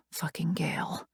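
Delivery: whispered curse under a sigh; bitter whisper. A narrator's reading. Cursing Gale to herself in a whisper. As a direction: spit the name in a breathless whisper.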